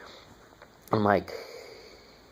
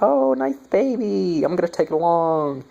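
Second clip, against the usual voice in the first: mocking sister; 9 semitones higher than usual. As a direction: coo it like a ditzy sister. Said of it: "Her sister's like".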